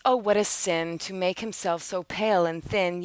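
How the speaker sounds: ordinary delivery, nothing marked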